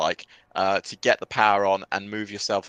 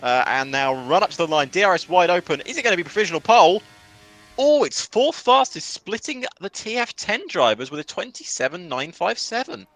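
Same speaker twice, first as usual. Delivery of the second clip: raised, building race call peaking at the finish; excited play-by-play; 5 semitones higher, 4 dB louder than usual. Real, not acted. Commentator calling a possible pole lap, excited play-by-play. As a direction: build the tension up to the line.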